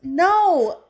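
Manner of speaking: loud, high drawn-out shout; long, high-pitched yell of denial; protesting. Real, not acted. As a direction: cry out a real protest at being teased. Protesting a Baker Act joke.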